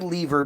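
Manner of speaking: "lever" said as LEE-ver; long ee at the start of "lever". Retold or imitated. Denying ever using that word, "lever" pronounced LEE-ver. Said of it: "I've never said lever before".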